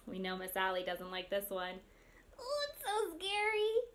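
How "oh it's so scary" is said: high, mock-scared voice; playful high-pitched dread; pretend fright. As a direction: act spooked by a scary animal. Pretends fear of the snake.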